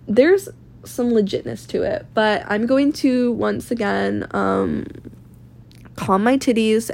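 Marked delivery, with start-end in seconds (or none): none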